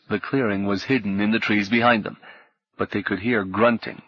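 plain reading voice

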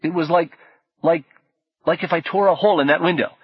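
fast, high, eager; tumbling out in excitement; excited, groping for words. Acted. Orlando struggling for words.

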